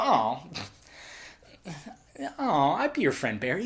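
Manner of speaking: soft, hushed, touched reply; tender sympathy. Acted. Fan answering lonely Barry's need for friends, tender sympathy. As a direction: melt quietly with mock tenderness.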